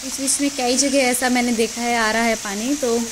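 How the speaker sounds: ordinary talk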